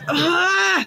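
high effortful yell; strained grunt shout. Acted. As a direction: shout with a final push. NPC gets his second wind.

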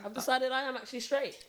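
high, earnest mock confession; mock coming out announcement. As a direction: announce it like a big reveal. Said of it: "Straight people never come out and say".

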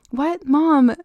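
put-on distraught child faltering to mother; stuttering child. A narrator's reading. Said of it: "Jamie stuttered".

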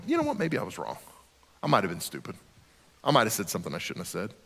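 humble admission. Acted. Believer owning up that maybe they were wrong, humble and hushed. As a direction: quietly concede it, voice dropped.

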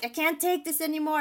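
high-pitched, contained exasperated outburst; mild mock yell, pitched well up; retold scream. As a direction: re-enact screaming back without full volume. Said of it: "I screamed back".

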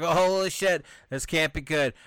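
loud, high panicked outburst; alarmed. Retold or imitated. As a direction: react in sudden alarm. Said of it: "everyone's like".

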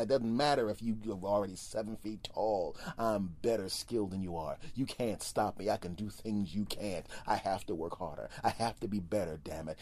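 ordinary talk, nothing marked

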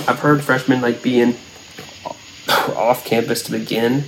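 ordinary talk, nothing marked

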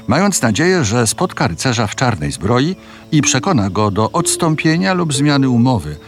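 normal talking voice

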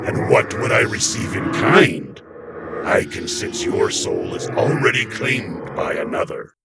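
slow whispery demon. A narrator's reading. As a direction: weigh the offer in a breathy hiss.

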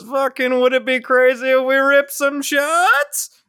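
imitating a colleague egging everyone on; coworker pitching shots. Salesman to his team suggesting shots at lunch, high and coaxing. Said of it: "one person says".

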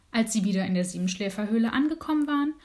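plain reading voice

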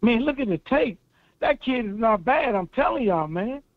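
usual speaking voice

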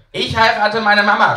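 put-on high, loud child's yell; a boy bragging at full volume; shouting child. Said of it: "schreit Siegwe".

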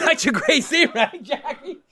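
high, squeaky voice between laugh and cry; choked up, near tears. Real, not acted. Host to tearful producer about film scene, high choked-up voice. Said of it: "You're making me cry".

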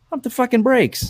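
high, slow mock plea to ease off; exasperated reining-in. Mentor reining in kids who study every night, high drawn-out exasperation. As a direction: pitch it way up and stretch each word. Acted reply reining in keen trainees.

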